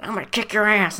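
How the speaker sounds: hammed-up angry coworker; playful fake fury; mock threat. [name] threatens after being hit.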